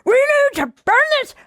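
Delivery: high, shouty angry rant; Michael Moore impression. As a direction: rant like an indignant activist. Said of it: "He's like".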